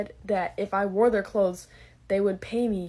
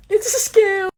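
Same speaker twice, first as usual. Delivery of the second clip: high, loud exasperated cry; loud outburst; 11 semitones higher, 10 dB louder, slower than usual. Real, not acted. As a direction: blurt out the shock. Realizing scam.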